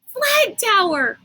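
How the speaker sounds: high, slow sudden exclamation; exclaimed. Acted. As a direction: it just dawned on her. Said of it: "she exclaimed".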